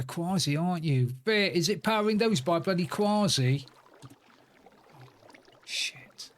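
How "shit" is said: whispered curse. Real, not acted. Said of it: "I forgot to drop that".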